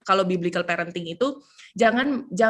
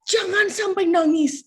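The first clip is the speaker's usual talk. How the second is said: fussing grandmother; 7 semitones higher, 3 dB louder, slower than usual. Retold or imitated. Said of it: "langsung kayak".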